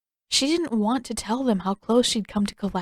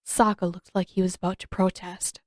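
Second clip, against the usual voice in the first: usual speaking voice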